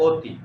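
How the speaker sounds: অতি with a closed o at the start, like ও; correct pronunciation. Shown as a demonstration. Teacher showing students how অতি really sounds, first vowel as ও. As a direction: say অতি opening on a rounded "o". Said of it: "অ না, অতি না, অতি".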